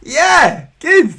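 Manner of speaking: excited. Real, not acted. Host reacting to cat comment.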